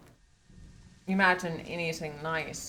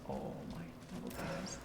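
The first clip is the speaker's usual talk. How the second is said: hushed, delighted whisper; 9 dB quieter, faster than usual. Acted. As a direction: whisper it like a creepy secret. Creepy reaction to snake room.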